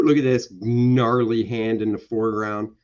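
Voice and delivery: ordinary talk, nothing marked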